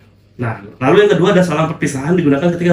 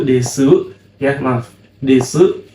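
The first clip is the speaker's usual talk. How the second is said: normal talking voice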